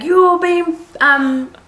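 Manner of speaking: lightly voiced angry drunk; mock passenger voice. Passenger's accusation.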